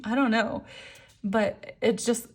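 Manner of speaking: ordinary delivery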